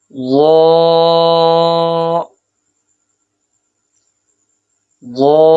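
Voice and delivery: وا drawn out with a full rounded mouth; full-mouthed letter. Shown as a demonstration. Teacher showing students a heavy letter, full and drawn out. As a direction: fill the mouth on وا. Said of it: "منہ بھر کر۔".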